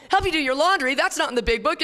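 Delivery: high, loud mock indignation; hammed-up protesting newcomer; indignant newcomer. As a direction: squeal it like an offended newcomer. Newcomer objects to laundry task.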